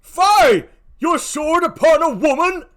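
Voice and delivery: high, loud protest; an old uncle cries out as a woman is stabbed; shocked outcry. Acted. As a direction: cry out in shock. Gratiano sees stabbing.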